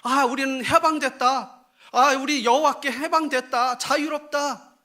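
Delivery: loud, high cries of liberation; mock jubilation. Israel boasts of being freed from God, mock jubilant. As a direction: celebrate loudly, acting out the runaway believers. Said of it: "이게 무슨 말이에요?".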